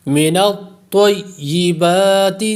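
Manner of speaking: من الطیبات recited slowly, heavy ta and a long ba; tajweed recitation. Shown as a demonstration. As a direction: give ta weight and stretch ba in من الطیبات. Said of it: "تا کو جماؤ سے پڑھیں گے".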